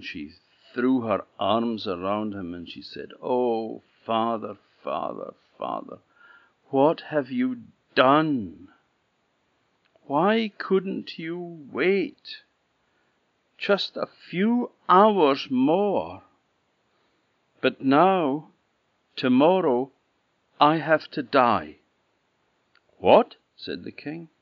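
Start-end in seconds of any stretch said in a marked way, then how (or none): 3.2-21.8 higher, slower lament; grieving princess. Acted. Princess to her father, lamenting her coming death, high and slow. 23.0-23.4 shocked king. Acted.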